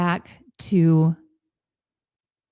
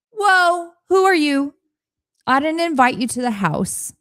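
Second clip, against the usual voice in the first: higher, startled whoa then firm questioning; startled challenge; 8 semitones higher than usual. Pretend. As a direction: confront an uninvited guest. Challenging the inner critic voice.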